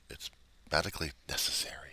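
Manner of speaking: a whispered mock of the jargon; whispered aside. Real, not acted. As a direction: whisper the phrase like a sneer.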